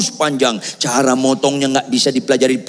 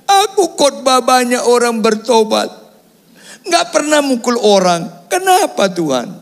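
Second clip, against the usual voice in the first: high-pitched retold wail to God; hammed-up tearful plea, pitched way up; mock crying prayer; 13 semitones higher than usual. Jailed preacher crying to God at night, mock wailing prayer. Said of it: "Malam saya nangis".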